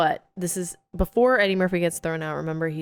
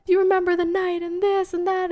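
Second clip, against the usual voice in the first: passionate plea; 12 semitones higher than usual. Retold or imitated. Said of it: "yelling, like, passionately".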